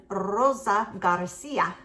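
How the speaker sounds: slow, put-on Spanish accent. Shown as a demonstration. Vlogger thanking a new subscriber by name, in a playful Spanish accent. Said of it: "That was my Spanish accent".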